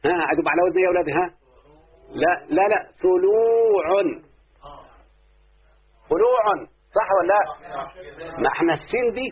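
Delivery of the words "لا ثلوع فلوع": slow drawn-out pattern. Shown as a demonstration. Teacher to pupils, sounding out the pattern slowly. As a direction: draw out ثلوع syllable by syllable for the class.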